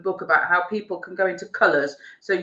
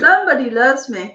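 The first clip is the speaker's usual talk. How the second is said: playful singsong; 6 semitones higher, 8 dB louder than usual. Real, not acted. Teasing singsong after "I love you.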